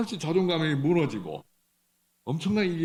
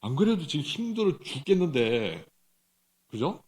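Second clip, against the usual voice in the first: ordinary talk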